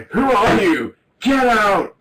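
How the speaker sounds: high, loud mock panic at the phone; hammed-up terrified shriek, get out; mock scared yell. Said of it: "And I'm like".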